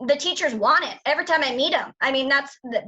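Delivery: usual speaking voice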